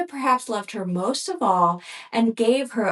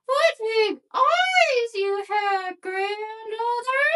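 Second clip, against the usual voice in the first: put-on high, slow, sweet little girl; 12 semitones higher, much slower than usual. A narrator's reading. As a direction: answer innocently in a high little girl's voice. Girl marvels at the wolf.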